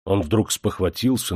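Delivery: plain reading voice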